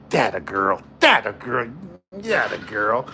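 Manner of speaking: deep low mock cheer. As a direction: growl out a teasing cheer.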